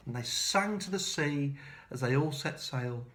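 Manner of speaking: ordinary delivery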